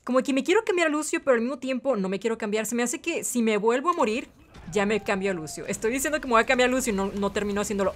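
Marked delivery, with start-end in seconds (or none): none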